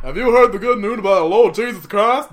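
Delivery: hammed-up Jehovah's Witness at the door; preachy evangelist. Mocking door-knocking evangelists, loud mock preacher voice.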